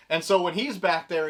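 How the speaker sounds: usual speaking voice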